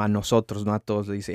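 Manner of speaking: normal talking voice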